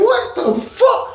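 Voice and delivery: high drawn-out disbelief; shocked. Retold or imitated. Stunned at his cellmate dancing, slow high disbelief. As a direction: stretch it out in pure disbelief. Shocked at friend's pookie dance.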